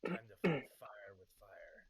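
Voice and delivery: loud, slow, grand bard proclamation; cheeky bard voice. Acted. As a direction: boom it out like a cocky bard. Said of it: "say in a cheeky bard voice".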